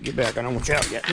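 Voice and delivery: ordinary talk, nothing marked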